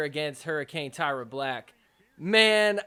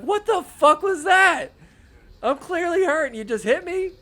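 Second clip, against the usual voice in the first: high, loud outraged shout; mock outrage; 11 semitones higher, 7 dB louder than usual. Injured player yelling at the opponent, mock outraged. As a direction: yell it like the hurt player. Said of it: "He's like".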